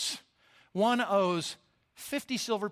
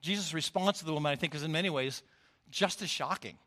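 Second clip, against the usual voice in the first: usual speaking voice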